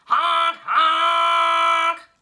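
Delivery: loud high honking car horn; horn honks. A narrator's reading. Taxi driver honking, loud imitated horn.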